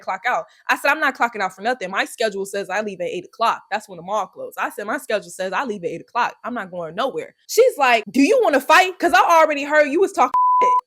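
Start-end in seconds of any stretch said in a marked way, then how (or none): none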